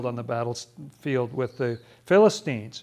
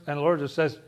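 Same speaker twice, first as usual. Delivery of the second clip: ordinary talk, nothing marked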